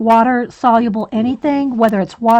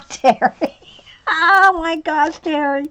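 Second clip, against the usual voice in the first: real laughter, pitched up on the exclamation; laughing; 5 semitones higher than usual. Real, not acted. Streamer reacts to Terry's message in the chat, laughing. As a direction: laugh helplessly through the name.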